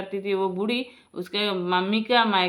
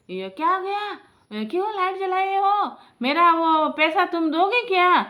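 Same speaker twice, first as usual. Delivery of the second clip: high, loud imitated telling-off; acted-out miserly father-in-law's outburst; scolding old man; 8 semitones higher, 5 dB louder than usual. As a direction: scold the daughter-in-law, pitched right up. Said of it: "ऐसे बोलता था दोस्तों".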